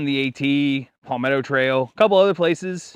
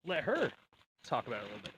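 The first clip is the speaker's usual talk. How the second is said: speaking off mic, distant and faint; mic handed over; 3 semitones lower, 18 dB quieter, faster than usual. Real, not acted. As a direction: voice fading away from the mic. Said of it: "give her the mic".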